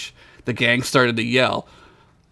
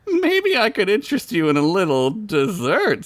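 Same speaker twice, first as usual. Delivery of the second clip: flirty wife voice; 6 semitones higher than usual. Acted. Wife seduces Bilbert.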